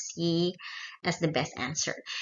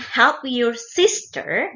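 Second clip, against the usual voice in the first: very slow with "sister" pushed up and louder; "sister" raised and loud as the main stress; 6 semitones higher, 5 dB louder, much slower than usual. Shown as a demonstration. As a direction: land the weight on "sister". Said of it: "penekanannya itu di sister".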